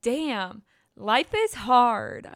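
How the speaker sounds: slow, loud title with a cracking voice; drawn-out, cracking podcast intro. Real, not acted. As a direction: let the voice crack upward on the show title. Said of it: "cringing at my voice there. The crack".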